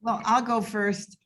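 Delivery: ordinary talk, nothing marked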